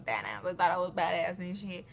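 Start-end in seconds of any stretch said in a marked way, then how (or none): 0.1-0.4 1 mock tough. Recalling feeling tough.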